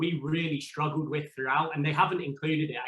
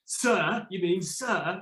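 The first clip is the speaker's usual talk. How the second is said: a mock stern detective, pitched up high; stern correction; 5 semitones higher, much slower than usual. Detective correcting the contestant who forgot sir, mock stern. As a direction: correct him sharply like a strict cop.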